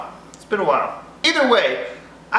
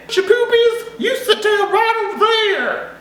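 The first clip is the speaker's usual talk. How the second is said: mom's scolding in a much higher, hammed-up Italian accent; mock Italian accent; 12 semitones higher than usual. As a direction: do a silly high Italian-accent mom.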